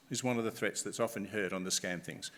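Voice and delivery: usual speaking voice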